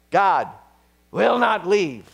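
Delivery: loud, high impassioned preaching; fiery pulpit declaration; preaching at full force. Real, not acted. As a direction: proclaim it to the back row. Sermon climax on salvation.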